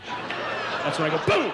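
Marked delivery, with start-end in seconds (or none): 1.2-1.5 loud, high exploding "boom"; sudden boom. Acted. Acting out dropping dead at dinner, sudden boom. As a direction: blurt out an abrupt imitated boom.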